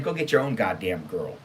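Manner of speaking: ordinary delivery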